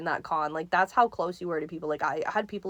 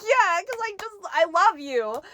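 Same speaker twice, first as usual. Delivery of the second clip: high, giddy gush through a laugh; gushing fan; 11 semitones higher, 4 dB louder than usual. Retold or imitated. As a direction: gush at your idol. Gushing to her idol.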